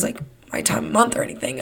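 hushed, breathy aside about her period; quick embarrassed whisper. Real, not acted. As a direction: drop to a whisper for the awkward bit.

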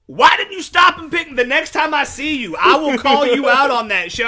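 high, loud, hammed-up telling-off; indignant scolding. Walker confronting the friend who drove by, indignant. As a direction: act out calling a friend out.

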